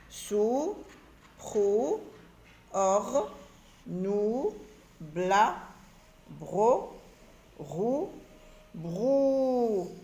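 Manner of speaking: one drawn-out syllable at a time, from "Sou" to "brou"; slow syllable drill, vowels held on "rou" and "brou"; reading syllables aloud. Shown as a demonstration. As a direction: stretch each syllable like "prou", pausing between them. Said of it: "forme et lit des syllabes".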